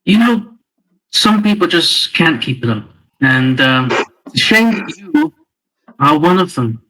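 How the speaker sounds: condescending taunt. Acted. Player taunts the goblin in character.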